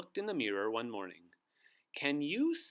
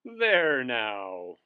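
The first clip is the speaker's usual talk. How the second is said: chuckling; 10 dB louder than usual. Acted. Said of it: "chuckled the wizard".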